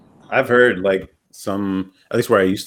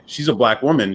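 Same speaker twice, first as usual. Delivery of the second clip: ordinary delivery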